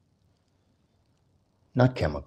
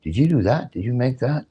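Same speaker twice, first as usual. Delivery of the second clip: rising, higher and higher in amazed praise; amazed admirers; 5 semitones higher than usual. Retold or imitated. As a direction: gush like an impressed guest. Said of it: "And people go".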